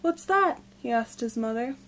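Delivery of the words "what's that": put-on higher-pitched, curious child's question; surprised. A narrator's reading. Big brother asking his mother what she means, surprised. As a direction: ask it with sudden wonder. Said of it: "widened his eyes and looked surprised".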